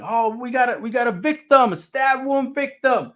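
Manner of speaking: mock ER alarm, high and loud; urgent call-out. ER staff announce a stabbed patient, urgently calling out. ER staff react to stab victim.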